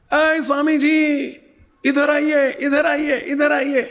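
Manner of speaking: high, slow call across the street, imitating an old woman. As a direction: call the monks over from her cot. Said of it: "એમણે બૂમ પાડી".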